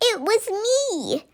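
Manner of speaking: high, soft little monster voice; a tiny creature owning up. A narrator's reading. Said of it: "said the tiny monster".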